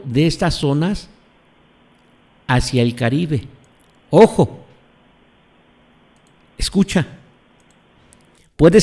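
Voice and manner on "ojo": ordinary talk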